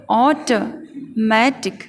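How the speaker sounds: very slow, split into AW-tuh and MATIC; teacher's slow syllable-by-syllable demo; broken into parts. Shown as a demonstration. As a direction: sound out automatic piece by piece, with gaps. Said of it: "एक साथ बोलेंगे तो".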